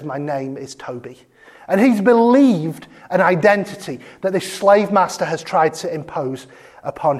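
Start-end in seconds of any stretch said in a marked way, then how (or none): 0.0-1.3 much softer and lower, resigned; broken, defeated. Retold or imitated. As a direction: give in to the imposed name, barely audible. The most heartbreaking moment.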